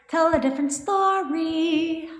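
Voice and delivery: slow, high, held "story"; high singsong refrain. Shown as a demonstration. Coach nagging viewers to change their thinking, high singsong refrain. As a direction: sing it out high, stretching "story". Repeats the advice, now high.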